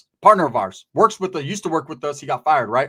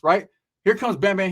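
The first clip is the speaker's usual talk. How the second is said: ordinary delivery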